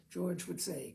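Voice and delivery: usual speaking voice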